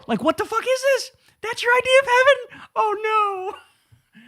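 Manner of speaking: squeaky high disbelief; incredulous shriek. Real, not acted. Shocked outburst at idea.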